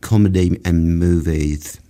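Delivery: lightly done British Russell Brand impression, rushed in the middle; a quick, half-hearted go at Russell Brand's British accent, slowing at the end. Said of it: "a Russell Brand kind of accent where he go".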